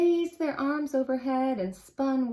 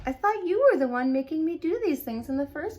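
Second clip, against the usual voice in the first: high, quick questioning; puzzled; 6 semitones higher, 4 dB louder, faster than usual. Acted. On questions his anger, high and quick. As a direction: push back in disbelief.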